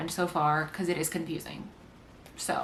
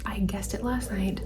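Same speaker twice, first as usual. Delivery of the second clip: whispering; 4 dB quieter than usual. Real, not acted. Whispering while at work.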